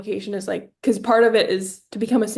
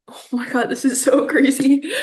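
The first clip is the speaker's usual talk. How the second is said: overwhelmed amazement; 4 semitones higher, 3 dB louder than usual. Acted. Acting out Timmy's overwhelmed reaction.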